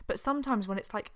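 ordinary talk, nothing marked

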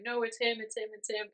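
panicked guests begging, pointing at the killer; frantic pleading. Retold or imitated.

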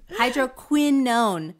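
hydroquinone slow and loud on QUIN; leaning on QUI. Shown as a demonstration. Reader proving herself to co-host, overdone QUIN in hydroquinone. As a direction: overstress the QUIN in hydroquinone, holding the n. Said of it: "You really wanna get the qui in there".

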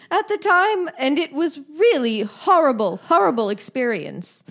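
high, hammed-up British accent. Co-host reads councillor's horror, mock British accent. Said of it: "decided to do an accent".

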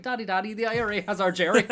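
high-pitched Northern Irish film quote; Northern Irish accent. Shown as a demonstration. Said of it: "do a Northern Irish accent".

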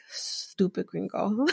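soft, slow, scornful aside under the breath; muttered insult. Retold or imitated. Retelling the wife's side-eye insult, a soft slow mutter.

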